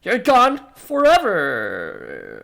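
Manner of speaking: drawn-out cry, sliding down in pitch; anguished scream. Acted. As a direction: wail the last word very slowly. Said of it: "He screams, half in sorrow, half in rage".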